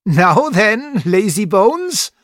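high, loud, slow teasing German tutor. A narrator's reading. Tutor teasing the boy while tickling his heels, high and loud. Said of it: "He began laughingly to tickle my heels".

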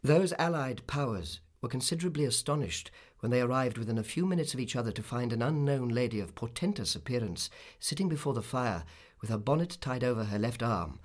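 plain reading voice

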